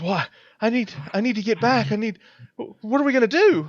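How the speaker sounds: put-on a scared teen losing it a little; panicking girl. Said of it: "She's panicking".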